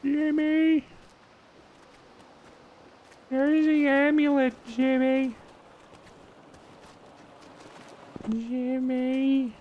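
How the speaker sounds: put-on high whiny calling kid; Carl Wheezer voice. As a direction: shout for Jimmy, nerdy and high.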